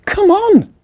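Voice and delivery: put-on high, loud, barely hushed child voice; urgent stage whisper. A narrator's reading. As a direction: urge your brother along. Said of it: "whispered Victoria".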